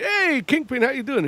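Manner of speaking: high, lively mock greeting; everybody greeting Kingpin. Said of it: "where everybody's like".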